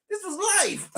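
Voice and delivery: high, cracking shout through laughter; laugh-yell. Real, not acted. Streamer breaking into laughter yelling at chat.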